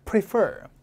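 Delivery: "prefer" as pri-FER, the end punched; stress on second syllable. Shown as a demonstration. Teacher having the class repeat, "prefer" stressed at the back. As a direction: hit the FER of "prefer" and let it fall. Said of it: "강세 뒤에 있습니다".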